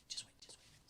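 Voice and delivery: whispering to friends to hold on. Retold or imitated. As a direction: hush them under your breath. Hushing friends before showing video.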